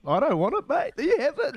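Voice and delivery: jokey high-pitched protest, rising at the end; mock panicked refusal. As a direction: squeak out a mock-nervous plea to a mate. Acting out own panicked refusal.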